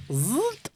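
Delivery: high, quick "zoot" noise; imitated machine zip.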